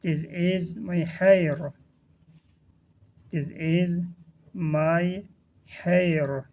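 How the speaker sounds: slow, word-by-word teaching delivery; drawn-out words with small gaps between; slow model reading. Shown as a demonstration. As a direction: model the phrase slowly for learners. Teacher models phrase for learners.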